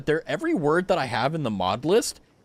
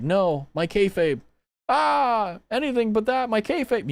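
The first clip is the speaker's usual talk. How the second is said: mock anguish; 6 semitones higher, slower than usual. Mock despair over broken kayfabe.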